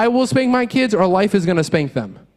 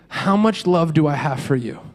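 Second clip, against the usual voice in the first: tender dad; 7 semitones lower than usual. Retold or imitated. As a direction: hush them gently like a soothing father. Dad comforting his crying kids.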